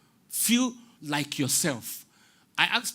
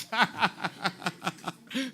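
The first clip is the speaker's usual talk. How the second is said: long run of laughter, higher than speech; real hearty laugh; 4 semitones higher than usual. Real, not acted. Speaker to interviewer after losing the question, hearty laugh.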